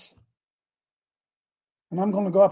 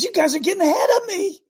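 much higher, quick and playful rebuke; God's teasing reply; 13 semitones higher, 5 dB louder, much faster than usual. Retold or imitated. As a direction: toss it off fast like a light scolding. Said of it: "He said".

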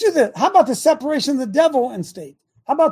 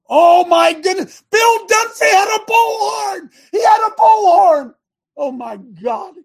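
much higher, louder, slow hammed-up shock; mock horror; 9 semitones higher, 8 dB louder than usual. Real, not acted. Host mocking the bullhorn evidence, hammed-up shocked cry. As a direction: wail it like a scandalous crime.

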